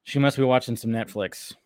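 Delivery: normal talking voice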